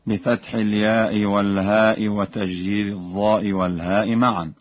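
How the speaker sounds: ordinary talk, nothing marked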